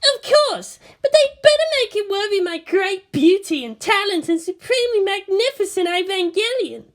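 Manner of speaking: put-on high, vain bragging girl; boastful girl voice. A narrator's reading. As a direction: brag about your beauty. Asuka boasting.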